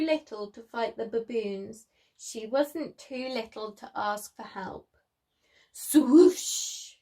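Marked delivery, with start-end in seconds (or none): 5.7-6.9 loud whooshing sound effect; swoosh. A narrator's reading. Storyteller makes Lizzie's run go swoosh. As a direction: whoosh it out like a rush of wind.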